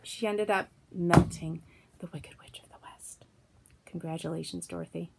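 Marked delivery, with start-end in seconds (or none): 0.0-3.2 whisper. Real, not acted. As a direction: breathe it as an aside. Whispered aside.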